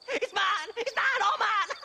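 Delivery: high, giddy cry over his treasure; manic gloating. Acted. Crazed prospector gloats to the stranger about his map. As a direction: crow it out like a greedy madman.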